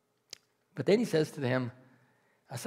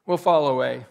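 boastful Peter; 6 semitones higher, 11 dB louder than usual. Retold or imitated. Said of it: "and he said".

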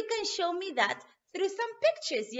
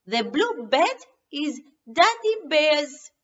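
usual speaking voice

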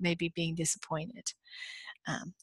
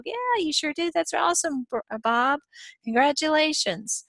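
fake cheerful congratulations; 8 semitones higher than usual. Narrator taunting coworker Bob.

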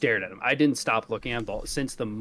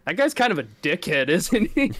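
raised-voice retold jab, climbing higher; way too loud; 5 semitones higher, 5 dB louder than usual. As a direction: say it loudly enough to be overheard. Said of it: "I'm way too loud".

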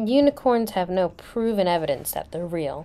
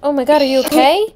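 sudden high, loud alarmed cry; teacher finds the sick student, alarmed and loud; 6 semitones higher, 9 dB louder, faster than usual. Pretend. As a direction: burst out in pretend alarm at a sick kid. Finds classmate sick in bathroom.

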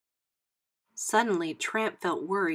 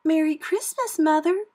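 high, loud festive pup voices; chirping puppies; 9 semitones higher, 6 dB louder than usual. A narrator's reading. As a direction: greet Mother in a high puppy voice. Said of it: "said the puppies".

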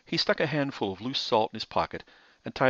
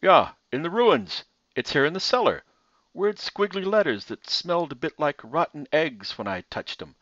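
higher, louder simple hunchback voice; hunchback assistant; 6 semitones higher, 6 dB louder than usual. A narrator's reading.

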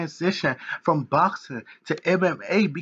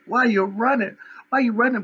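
mock taunting fans; 6 semitones higher than usual. Upset fans jeering at Floyd, higher, mocking. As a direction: taunt him like an upset fan. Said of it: "they gonna be like".

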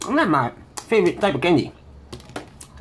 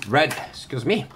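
usual speaking voice

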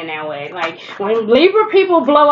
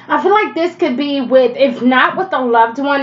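normal talking voice

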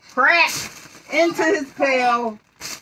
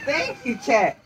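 ordinary delivery

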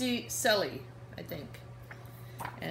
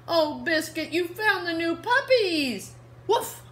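higher, louder delighted discovery; delighted girl; 9 semitones higher, 9 dB louder, slower than usual. Acted. Reading to kids, the girl delights in the new puppies. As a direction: gush over the new puppies.

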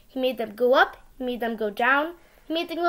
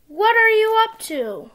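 calling out; 8 semitones higher, 6 dB louder than usual. Acted. Sam calling after Gus.

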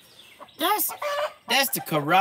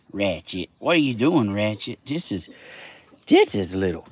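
ordinary talk

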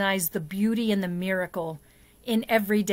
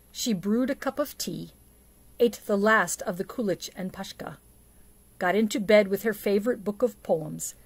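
story narration. A narrator's reading. Reads the Babushka story aloud.

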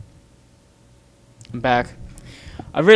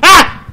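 short, high, loud shriek of fright; startled scream; 18 dB louder than usual. Real, not acted. Player caught by a jump scare, startled scream. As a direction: scream in sudden shock.

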